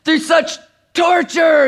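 slow, hammed-up lament; mock-anguished outcry, stretched out; discouraged protest. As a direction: imitate a daughter dramatically protesting her suffering. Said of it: "she was so discouraged".

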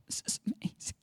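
hushed, breath-only amazement; barely voiced wonder; awed whisper. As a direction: whisper it as if overcome. Overcome by a kind act.

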